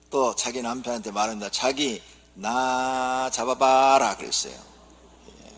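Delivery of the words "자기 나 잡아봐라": very slow teasing sing-song; coy drama heroine. Acted. Seoul wife teasing husband, slow drama-style coo. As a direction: draw it out like a drama flirt. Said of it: "서울 아내가".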